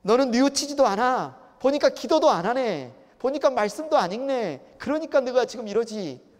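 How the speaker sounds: loud, high-pitched berating; acted attack, pushing harder with every line; harsh, aggressive accusation. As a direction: hammer each charge home relentlessly. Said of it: "가혹하게 점점 더 공격적으로".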